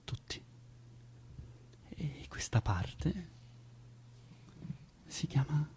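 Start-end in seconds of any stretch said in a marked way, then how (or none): 1.9-5.7 hushed slow whisper. Acted. Actor confides to Zoe in a whisper. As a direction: lean in and breathe it like a secret.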